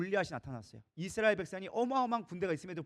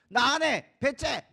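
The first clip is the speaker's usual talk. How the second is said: loud, high, stubborn outburst; defiant refusal; 5 semitones higher, 6 dB louder, much slower than usual. Acted. Overwhelmed new leader quitting before the people, defiant refusal. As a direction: dig in and refuse outright.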